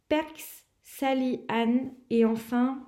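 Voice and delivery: ordinary delivery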